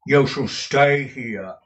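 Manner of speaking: loud, slow, firm character voice; drawn-out, emphatic command; Newman Noggs. A narrator's reading. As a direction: order it slowly and loudly. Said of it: "said Newman".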